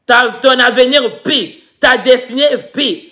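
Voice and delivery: usual speaking voice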